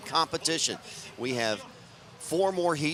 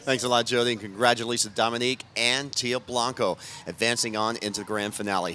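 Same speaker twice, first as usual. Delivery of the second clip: ordinary delivery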